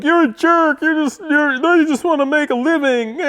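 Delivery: high-pitched mocking commenter voice; whiny commenter. Imagined commenters scolding the storyteller, mocking whine. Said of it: "how many comments below will be like".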